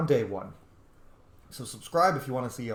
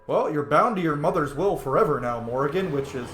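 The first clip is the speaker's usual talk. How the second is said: ordinary talk, nothing marked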